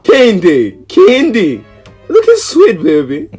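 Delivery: excited calling. Acted. Calling out to the stylist.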